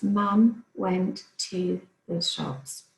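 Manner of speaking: slow, smooth reread of the sentence; slow fluent reread. Shown as a demonstration. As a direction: read the whole sentence back slowly. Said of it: "go back over the sentence and reread it for fluency".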